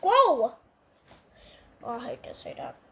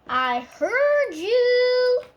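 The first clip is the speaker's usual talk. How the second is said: pointed reply; 7 semitones higher, 6 dB louder, much slower than usual. Pretend. Puppet overhears being insulted.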